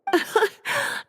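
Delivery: a short, high, light laugh; amused chuckle. Acted. Librarian laughing at Paimon's question about Klee, amused chuckle. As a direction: let a little laugh escape at the idea.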